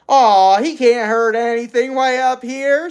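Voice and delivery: put-on high, loud boyish shrug-off; easygoing boy. A narrator's reading. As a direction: brush off the worry like a kid. First boy voiced high.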